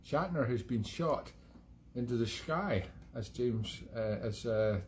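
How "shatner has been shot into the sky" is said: slow, lightly done Connery: "Shatner has been shot" with slurred sh sounds; a hint of Sean Connery, slushy sh. Mocking William Shatner, a light Sean Connery impression. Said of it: "as Sean Connery would say".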